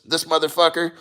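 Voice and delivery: usual speaking voice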